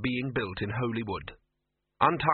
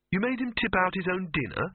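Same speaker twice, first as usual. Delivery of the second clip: higher-pitched horrified question; 8 semitones higher than usual. A narrator's reading. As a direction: ask it aghast, voice lifting. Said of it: "said Victor, horrified".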